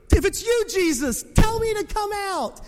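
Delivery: high, loud call across the water. Acted. Preacher acts Peter calling to Jesus from the boat, calling out. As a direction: call out boldly, pitch way up.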